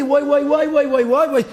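high, loud hawker's cry of וואי, וואי, וואי; market yelling. Retold or imitated. Son telling father how he yelled at the market, loud hawker's cry. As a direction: belt out וואי, וואי, וואי like a stall seller. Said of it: "אני חודש שלם צעקתי".

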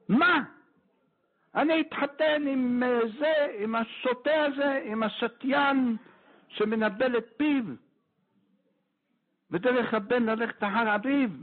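high indignant outcry, pitch jumps way up; the rabbi shouting in disbelief at the match; outraged shout. Retold or imitated. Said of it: "צעק, צעק". (צעקה נזעמת וגבוהה של הרב)

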